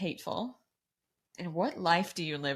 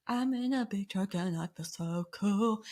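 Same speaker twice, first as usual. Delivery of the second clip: slow, showy boast in a put-on voice; playful mock bragging; goofy self-imitation; 3 semitones higher, slower than usual. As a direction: imitate yourself showing off, drawing it out. Re-enacts own silly truck video.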